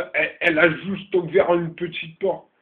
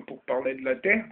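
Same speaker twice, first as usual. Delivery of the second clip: ordinary talk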